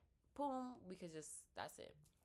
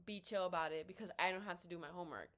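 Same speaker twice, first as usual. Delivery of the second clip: normal talking voice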